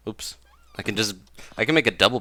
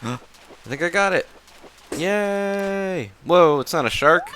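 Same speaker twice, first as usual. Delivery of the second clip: excited; 10 semitones higher, 6 dB louder, slower than usual. Real, not acted. Catching a fish, thrilled.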